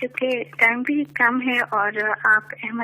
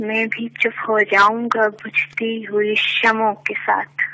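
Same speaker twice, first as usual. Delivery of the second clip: measured poetic recitation, lower; stately nazm recitation; 4 semitones lower, faster than usual. Acted. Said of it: "ایک نظم پیش کرنا چاہوں گی".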